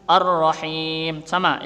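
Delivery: ordinary talk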